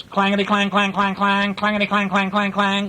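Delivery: high, ringing monotone clangs; imitated bell ringing. A narrator's reading. Little blue engine ringing her bell, imitated high clanging. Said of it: "she's ringing her bell".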